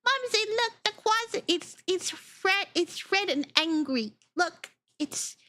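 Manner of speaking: put-on very high worried child pointing; child warning mom about the angry creature; alarmed kid voice. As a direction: squeak it out like a scared little kid. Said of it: "and it's like".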